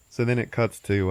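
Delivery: usual speaking voice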